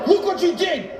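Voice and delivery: high, strained accusation; hammed-up outburst of blame; mock accusing yell. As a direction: pounce with a sudden high-pitched cry. Springs planned accusation on partner.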